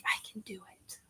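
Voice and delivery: barely audible whisper to oneself; re-enacted whispered pep talk; self pep talk. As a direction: whisper it to psych yourself up. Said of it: "I'm pepping myself up".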